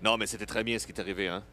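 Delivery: quick playful French; joking French. Pretend. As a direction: ham up being French for laughs. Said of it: "Sorry, I'm French".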